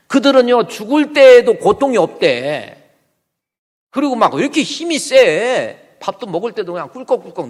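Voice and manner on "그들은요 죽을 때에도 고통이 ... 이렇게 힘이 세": louder, higher, slowing on the second line; loud indignant complaint. Acted. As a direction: spit out the unfairness to God. Asaph complaining about the wicked.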